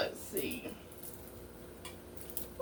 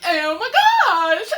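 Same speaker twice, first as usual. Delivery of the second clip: a light Valley Girl impression; 7 semitones higher, 7 dB louder, faster than usual. Shown as a demonstration. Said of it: "in the voice of a Valley Girl".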